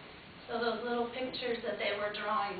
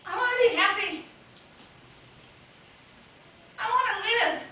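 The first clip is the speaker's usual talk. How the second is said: high, loud defiant cry; declaring; 9 semitones higher, 8 dB louder than usual. Acted. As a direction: proclaim it as a vow. Climbing out of the box.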